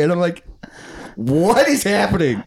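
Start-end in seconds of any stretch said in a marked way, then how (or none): 1.2-2.4 high, drawn-out mock disbelief; retold bewilderment. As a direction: stretch it out, acting baffled. Acting out own bewilderment afterwards.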